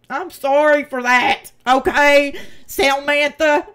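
loud, insistent "sorry", slow on "Samantha"; fed-up apology aimed at Samantha; shouted apology. Real, not acted. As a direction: call it out at her, exasperated.

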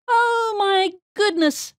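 high, slow mouse cry; alarmed squeak. A narrator's reading. Mouse teacher as the alligator bursts in, alarmed squeak. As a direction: squeal it out, drawn out and startled. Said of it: "squeaked Miss Mouse".

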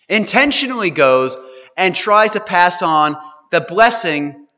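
loud, high, fast preaching; preaching at full force. Acted. Preacher pressing the congregation, at full force. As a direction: drive the point home to the congregation.

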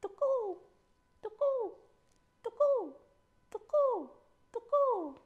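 high, loud gecko call, falling each time; piercing animal call on repeat; ear-splitting cry. A narrator's reading. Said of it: "awakened by an ear-splitting sound".

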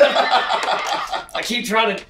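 slow, high, laughing loudly through it; real laughter breaking the words. Real, not acted. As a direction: crack up mid-sentence. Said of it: "game show voice here".

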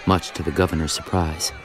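usual speaking voice

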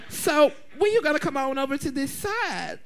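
lightly put-on impression of a friend; Kylie voice. Imitating a friend urging Silky over, put-on coaxing voice. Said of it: "Girl, that's my Kylie voice".

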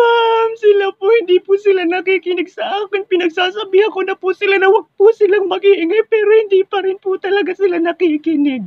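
very high, exaggerated sobbing wail; crying. Karing tattles to teacher on classmates, theatrical crying. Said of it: "napakapangit mo umiyak".

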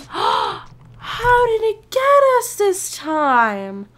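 slow, drawn-out dismayed gasp. Real, not acted. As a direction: stretch it out in disbelief. Dismayed gamer, slowed exaggeration.

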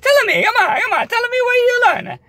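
imitating grandma pleading to hear; eager grandma. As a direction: coax them like a delighted nonna. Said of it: "Oh, yeah, yeah".

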